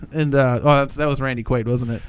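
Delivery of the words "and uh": ordinary talk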